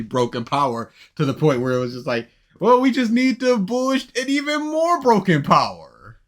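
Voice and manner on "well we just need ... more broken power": high, loud mock proclamation; mocking quote. Co-host mimics the reasoning behind a broken power, loud and mocking. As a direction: push it way up high, ridiculing the idea.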